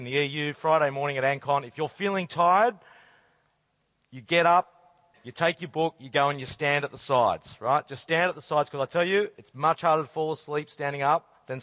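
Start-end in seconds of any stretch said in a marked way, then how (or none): none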